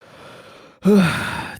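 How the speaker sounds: loud, long exhaled sigh; heavy sigh. Acted. Player as Conrad answering the bartender, heavy sigh. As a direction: let out a big in-character sigh.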